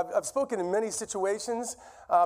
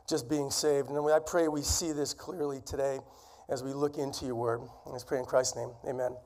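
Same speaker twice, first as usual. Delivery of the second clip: prayer; 4 semitones lower than usual. Acted. Leads the opening prayer.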